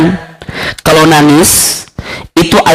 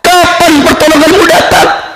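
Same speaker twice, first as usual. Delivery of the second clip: tearful prayer; 12 semitones higher than usual. Retold or imitated. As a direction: plead to God as if near tears. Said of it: "dia menangis sambil berkata". (doa memohon dengan suara tinggi memelas)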